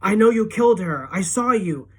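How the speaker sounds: loud, high accusing scream. A narrator's reading. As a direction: scream it at the killer. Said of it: "keep screaming".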